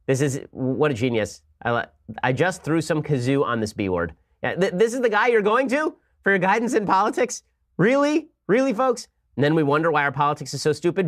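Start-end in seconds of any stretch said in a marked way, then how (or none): 4.4-9.1 incredulous. Real, not acted. Mocking [name] as political guide.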